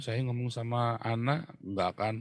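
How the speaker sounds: ordinary talk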